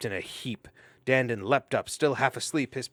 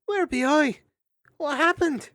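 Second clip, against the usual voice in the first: dazed hedgehog; 8 dB louder than usual. Acted.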